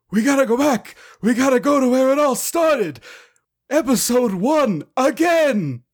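high, excited mad scientist; Doc Brown impression. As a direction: play the eccentric inventor. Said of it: "understudy performing the role of Doc Brown".

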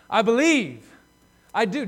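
loud, high and insistent; emphatic. Acted. Father to Jesus, emphatic loud cry of belief. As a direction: plead it hard, pushing the words out. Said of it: "the wording is emphatic".

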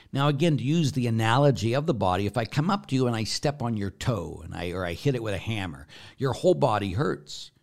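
usual speaking voice

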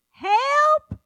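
high, loud Southern holler; Southern accent yell. Retold or imitated. Southern nursing home resident to her helper, yelled in Southern accent. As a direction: holler it with a drawn-out Southern twang. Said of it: "You gotta get the accent in there".

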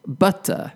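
"butter" said the British way, with a true T; British pronunciation. Shown as a demonstration. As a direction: pronounce the T in "butter", no R at the end. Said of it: "as a British person".

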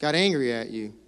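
earnest, humble apology with long pauses; sincere apology. Shown as a demonstration. Pastor modeling a real apology for couples, sincerely. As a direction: show the congregation how a real apology sounds. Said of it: "sounds like this".

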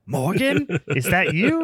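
high hopeful call for a lost wife; tree calling wife. Retold or imitated. Killer tree calls out to its tree wife, hopeful.

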